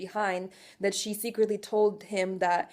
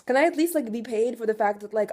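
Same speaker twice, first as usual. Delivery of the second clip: usual speaking voice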